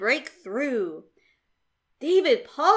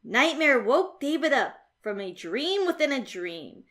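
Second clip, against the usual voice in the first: usual speaking voice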